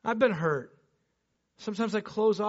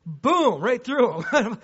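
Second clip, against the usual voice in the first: usual speaking voice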